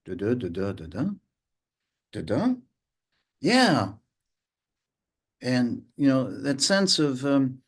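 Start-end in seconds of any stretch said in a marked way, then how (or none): 0.0-2.5 low, soft, unhurried da da; calm low steady beat; slow calm rhythm. Shown as a demonstration. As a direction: lay the syllables down gently. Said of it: "something that's more like".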